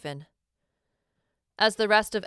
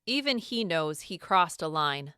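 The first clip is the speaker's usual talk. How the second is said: plain reading voice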